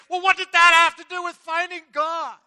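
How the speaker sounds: very high, loud indignant demand; spluttering outrage. Acted. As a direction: demand it, affronted. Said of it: "he asked the man indignantly".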